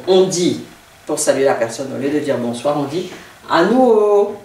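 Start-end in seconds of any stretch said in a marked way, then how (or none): 3.5-4.4 ANUO climbing loudly into a held ending; loud, drawn-out, rising ANUO. Shown as a demonstration. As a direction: lift and stretch the end of ANUO. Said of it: "qui remonte toujours".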